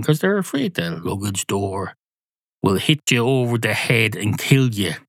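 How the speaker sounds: ordinary talk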